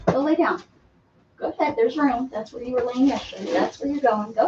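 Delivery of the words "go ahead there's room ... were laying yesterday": normal talking voice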